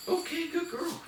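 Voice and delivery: very high, sing-song puppy praise; dog praise. Real, not acted. Trainer rewarding dog for touching bells, very high happy praise.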